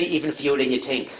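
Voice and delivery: put-on thick New York accent, dry comeback; deadpan New York accent. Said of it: "put on my heaviest New York accent".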